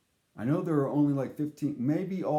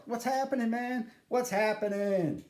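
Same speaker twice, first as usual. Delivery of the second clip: Isaac's voice; 10 semitones higher than usual. Retold or imitated. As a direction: sing out the catchphrase lazily. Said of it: "he's all".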